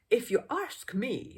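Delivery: a know-all man in the crowd; gossiping man. Acted. Crowd man voiced in story.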